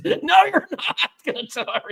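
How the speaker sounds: high, laughing no; laughing refusal. Retold or imitated. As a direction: shut it down through a laugh. Mark Burnett shuts him down, laughing.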